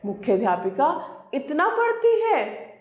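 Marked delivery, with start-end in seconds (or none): 1.3-2.7 surprised. Acted. Headmistress reacts in surprise.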